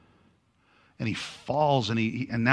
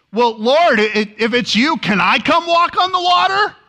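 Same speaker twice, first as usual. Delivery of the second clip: high, loud, eager asking; eager Peter; 12 semitones higher, 6 dB louder than usual. Acted. Preacher voices eager Peter asking Jesus, high and loud. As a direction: Peter bursting to get out.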